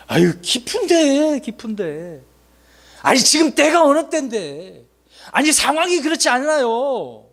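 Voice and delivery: high, slow whining excuses; whiny excuse-maker. Retold or imitated. Preacher mimicking a whining believer making excuses. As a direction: plead slowly in a high voice with lame excuses. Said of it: "이렇게 핑계를 댄다는 겁니다".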